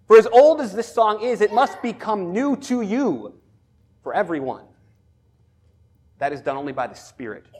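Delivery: loud, forceful pulpit proclamation; emphatic preaching. Acted. Preacher declaring God's promises to the congregation, loud and emphatic. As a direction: proclaim it with weight on each phrase.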